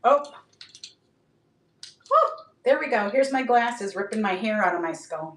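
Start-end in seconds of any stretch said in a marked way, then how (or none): none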